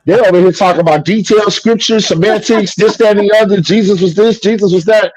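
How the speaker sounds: high, mocking sing-song of their talk; dismissive mock lecture; mocking churchgoers. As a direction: parody the nitpickers, pitched well up.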